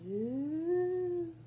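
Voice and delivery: a high, drawn-out ooh of wonder; high excited ooh; delighted ooh. Real, not acted. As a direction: marvel at the page. Opening the book to read.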